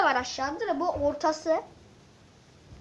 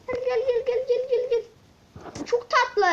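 cooing call; 8 semitones higher, 3 dB louder, slower than usual. Real, not acted. Calling the cute pet over.

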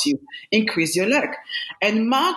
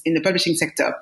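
normal talking voice

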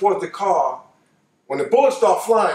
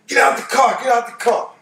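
barking orders; 5 semitones higher than usual. Retold or imitated. Robbers at the corner order them out, shouting. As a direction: bark it like a robber with a pistol.

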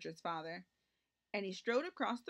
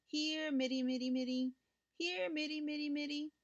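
high sing-song kitten call; 7 semitones higher than usual. Acted. Mother and boy searching outside for their kitten, calling out. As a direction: draw it out on one high held note. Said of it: "and they called".

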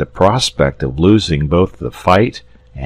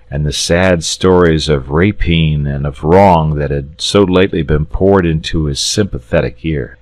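plain reading voice

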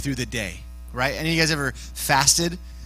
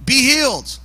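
short, higher-pitched command; commanding healing word; 7 semitones higher, 3 dB louder than usual. Retold or imitated. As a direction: speak it as a firm command. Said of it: "he just said".

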